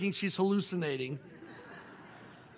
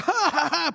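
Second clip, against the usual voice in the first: high gloating ha ha ha; retold gloating chuckle; mocking laugh; 7 semitones higher, 4 dB louder than usual. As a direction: imitate a smug man laughing. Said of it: "some guy's looking around".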